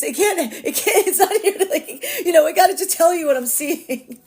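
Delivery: high, loud, laughing protest; laughing apology. Real, not acted. Reader apologizing to viewers for the reading, laughing loudly. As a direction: plead through laughter that it's not your fault. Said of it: "I'm sorry, guys".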